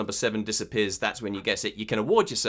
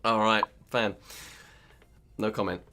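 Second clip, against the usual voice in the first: normal talking voice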